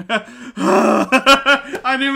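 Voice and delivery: slow, laughing told-you-so; giggling so hard the words drag; laughing through it. Real, not acted. Laughing at broken car.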